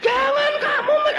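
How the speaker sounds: very high shout breaking into laughter; laughing shout. Acted. Preacher starts shouting and breaks into laughter. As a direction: start the yell then laugh.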